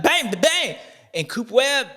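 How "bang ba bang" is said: high mock bang bang over the jumps; imitated bang of bikes over bumps; bike bumps noise. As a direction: imitate bikes hitting the blocks. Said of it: "you're like".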